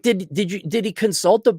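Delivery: ordinary talk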